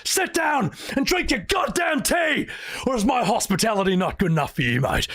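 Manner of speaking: high mock shout, then a sneer; imitating Sid yelling. Sid snapping at Cloud to sit and drink his tea, high hammed-up yell. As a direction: bark the order, then mock the guest. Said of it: "It's like".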